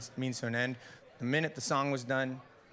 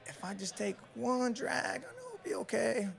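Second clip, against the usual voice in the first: much higher, pleading self-talk; craving voice; 10 semitones higher, 3 dB quieter than usual. Retold or imitated. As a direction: talk yourself into one more drag, higher. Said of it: "But I was like".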